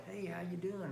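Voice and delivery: soft, slowed-down phone answer; quiet and unhurried, as Bob. Acted. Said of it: "I slow down".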